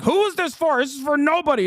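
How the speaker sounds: high, indignant mocking voice; mock outrage. Mimicking critics who assume a game has no audience, high mock outrage. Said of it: "The assumption that this is like".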